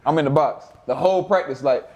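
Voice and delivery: usual speaking voice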